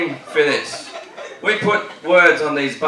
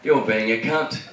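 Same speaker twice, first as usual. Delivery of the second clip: Mel Gibson quote. Retold or imitated. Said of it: "my best Mel Gibson quote".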